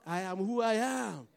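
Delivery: normal talking voice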